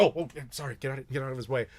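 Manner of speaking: hurried, hushed mock apology; softly flustered sorry; apologetic bystanders. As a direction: make way for someone, apologizing softly. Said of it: "And they'd be like".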